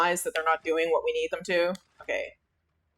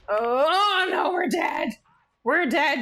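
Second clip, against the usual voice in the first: panicked shout; 8 semitones higher, slower than usual. Real, not acted. Getting killed in the game.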